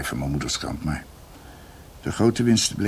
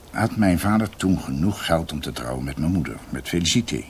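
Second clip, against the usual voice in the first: ordinary talk, nothing marked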